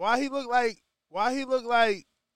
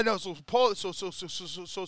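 ordinary talk, nothing marked

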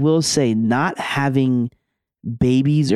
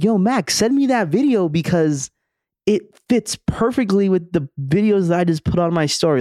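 eager, higher-pitched plea; pitched up with sudden excitement; excited retold request; 6 semitones higher than usual. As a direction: retell it as an urgent, excited ask. Retelling her eager request for video.

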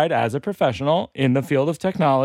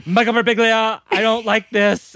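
distressed intern; 8 semitones higher, 4 dB louder than usual. Acted. Overloaded laptop complaining to its owner, mock distress. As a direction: play an intern close to breaking down. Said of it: "having a nervous breakdown like an intern".

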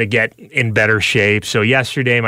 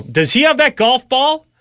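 shouting a question across the house, pitched way up; retold yell to the other room; 12 semitones higher than usual. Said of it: "And I yell".

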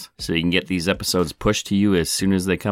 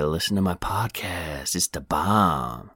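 self-mocking plug; 4 dB quieter, slower than usual. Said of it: "when I say".

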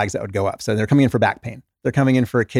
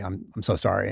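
much softer, sheepish sorry; sheepish apology; 3 semitones lower, 7 dB quieter than usual. Retold or imitated. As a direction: mumble a guilty apology. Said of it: "people who apologize".

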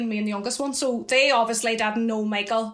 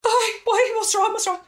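much higher, alarmed questions; retold alarm; 10 semitones higher, 3 dB louder than usual. Sister answering the strangers, much higher and alarmed. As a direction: sound suddenly worried, pressing for an answer.

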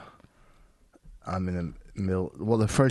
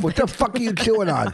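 high loud disgusted yell; disgusted outburst; 13 semitones higher, 5 dB louder than usual. Real, not acted.

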